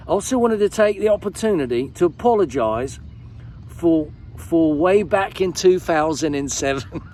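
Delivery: high, hammed-up British accent; mock British accent. Shown as a demonstration. Vlogger performs fan's letter in fake British accent. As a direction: read it like an American doing a Brit. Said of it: "I should read it like this".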